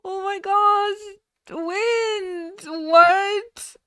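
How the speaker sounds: high, loud, drawn-out gasping exclamations; real shock. Real, not acted. Streamer stunned by a viewer's alert, shocked. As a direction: cry out in stunned disbelief. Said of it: "deep shock at the moment".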